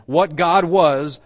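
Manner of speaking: very slow, loud WHAT GOD WAS; emphatic stress. Retold or imitated. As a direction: drive home WHAT GOD WAS, high and forceful. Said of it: "He's stressing the essence and quality".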